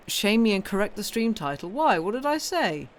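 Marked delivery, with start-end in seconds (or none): none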